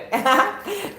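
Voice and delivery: high burst of laughter. Real, not acted. Streamer laughing out at viewers' comments. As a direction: laugh out at the chat.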